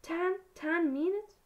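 stammered, high and loud, rising sharply; shocked croak. A narrator's reading. Harry to Dobby on hearing the deadline, shocked croak. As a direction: repeat it in disbelief, pitch soaring.

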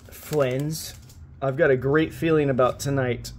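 normal talking voice

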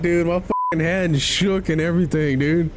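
high, breathless and rattled; shaken after a scare. Real, not acted. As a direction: still shaky from the fright. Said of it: "my f***ing hand shook".

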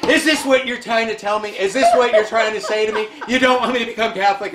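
high-pitched retold plea at a locked church door; desperate plea to God. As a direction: retell begging heaven for a sign. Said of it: "I'm audibly doing this".

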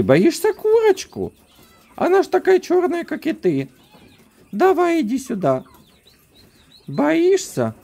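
very high squeaky coaxing voice. Real, not acted. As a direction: coax the dog in a squeaky falsetto. Coaxing dog toward a chicken.

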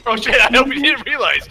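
ordinary talk

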